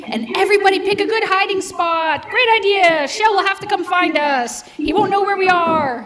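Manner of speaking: ordinary delivery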